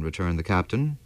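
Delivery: ordinary delivery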